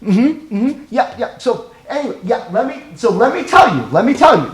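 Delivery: high, eager cutting-in, itching to speak; mock over-eager listener butting in; impatient interrupter. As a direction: act like you can't wait to jump in. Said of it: "they just have that look like they can't wait just to tell you what they think".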